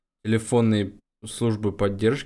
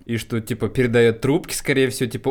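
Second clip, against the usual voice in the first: ordinary delivery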